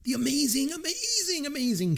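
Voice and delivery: swooning high praise; gushing. Real, not acted. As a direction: pitch soaring on each amazing. Gushing about Karen Allen.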